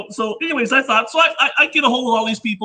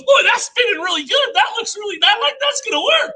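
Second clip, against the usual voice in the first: higher, wowed by the spinning thing; excited onlooker; 10 semitones higher than usual. Retold or imitated. Relative marvels over the inventor's shoulder at spinning machine, excited. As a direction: imitate an impressed relative, pitched high.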